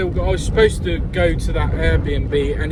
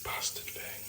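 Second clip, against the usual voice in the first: whispered curse; 21 dB quieter, slower than usual. Real, not acted. Muttered curse at stuck part.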